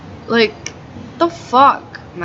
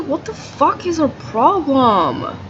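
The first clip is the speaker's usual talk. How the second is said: retold disbelief, higher and slow; incredulous, stretched-out complaint; mock outrage; 6 semitones higher, slower than usual. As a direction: drag it out like you can't believe her. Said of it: "And I'm just like".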